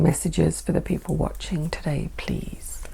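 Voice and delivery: soft whispered plea; whispering. Real, not acted. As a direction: whisper it to the cards. Whispered request to the cards.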